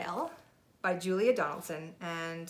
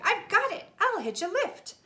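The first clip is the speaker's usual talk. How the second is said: much higher, louder eager squeak; a sudden bright idea, cried out; tiny snail bursting with an idea; excited cry; 7 dB louder than usual. Acted. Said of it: "then cried".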